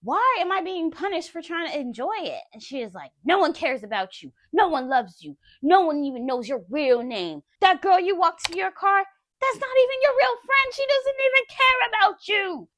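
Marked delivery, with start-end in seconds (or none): 0.0-2.4 high, loud indignant protest; getting mad. Retold or imitated. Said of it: "I'm starting to get mad". 3.2-12.7 loud rant climbing to a high scream; raging tirade. Retold or imitated. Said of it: "she's still going".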